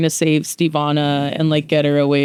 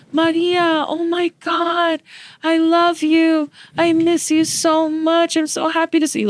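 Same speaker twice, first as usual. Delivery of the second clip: high, slow, gushing voice; overjoyed greeting; 12 semitones higher, slower than usual. Retold or imitated. Greeting her dead Nana in a dream, high and slow with joy. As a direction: squeal it with loving delight.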